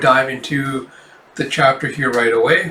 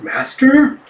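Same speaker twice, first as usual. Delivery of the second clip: high, louder questioning call; acted bewilderment, pitched way up; puzzled query; 11 semitones higher, 5 dB louder than usual. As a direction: interrupt with a baffled, rising question. Apprentice puzzled by master's solo talk.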